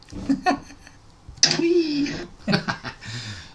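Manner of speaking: laughing loudly at own joke; big high laugh; high burst of laughter. Real, not acted.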